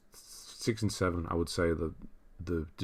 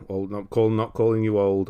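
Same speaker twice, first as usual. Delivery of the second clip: normal talking voice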